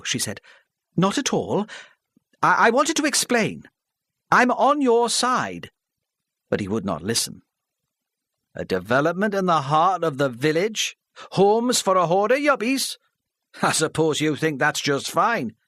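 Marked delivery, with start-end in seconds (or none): 1.0-5.7 put-on higher, pleading older woman, halting; flustered older lady. Mrs Parry pleading with the hostile crowd, flustered. 8.5-15.5 louder, higher scornful local man; scornful villager. Acted. Charlie scoffing at Mrs Parry before the hall, scornful.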